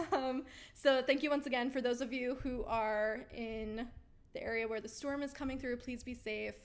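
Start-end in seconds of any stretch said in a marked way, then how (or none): none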